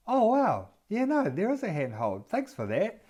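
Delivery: high-pitched, acted realisation; playfully amazed and grateful; pleasant surprise. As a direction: react as if you only just noticed it. Acted discovery of the handhold.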